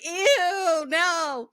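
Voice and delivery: high, loud, drawn-out playful revulsion; mock disgust. Host retelling reaction to ASMR suggestion, hammed-up disgust. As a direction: recoil loudly, half laughing.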